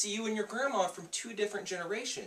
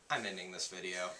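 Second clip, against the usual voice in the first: defeated; 10 semitones lower, 7 dB quieter than usual. Acted. Defeated sigh.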